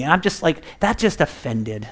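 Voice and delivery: ordinary talk, nothing marked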